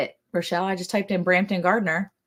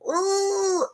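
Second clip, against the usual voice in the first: high, drawn-out "ooh"; mock pepper wail; 13 semitones higher, 3 dB quieter than usual. Peppers begging to be pulled off, mock wail. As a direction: wail like a pepper hanging in agony.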